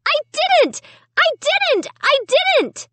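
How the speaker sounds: high, desperate repeated wail. A narrator's reading. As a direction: plead desperately. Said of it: "I wailed".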